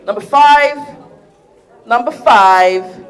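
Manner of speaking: bingo caller. Pretend. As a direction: stretch each number out like a bingo hall caller. Bingo-style number calling.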